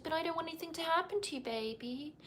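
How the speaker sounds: mock-cutesy, high and very soft; quick high coo, imitating her; bride's voice impression. Bride fretting over his seatbelt, mimicked soft high coo. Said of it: "she speaks, like, kind of like this".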